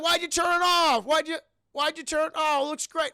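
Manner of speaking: high, loud complaining dad; acted-out outraged whine; indignant protest. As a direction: imitate a man wailing over his spoiled prize. Dad protests lamp being turned off.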